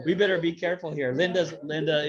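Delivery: ordinary talk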